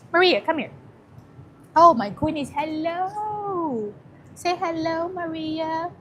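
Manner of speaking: high, sing-song talk to a dog. Real, not acted. Coaxing the shy dog Maria on stream, cooing baby talk. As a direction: coo at the puppy to play.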